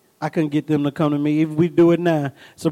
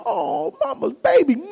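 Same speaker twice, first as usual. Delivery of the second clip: cooing mother; 9 semitones higher than usual. Retold or imitated. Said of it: "a wife will see it like".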